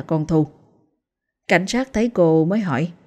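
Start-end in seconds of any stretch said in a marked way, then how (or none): none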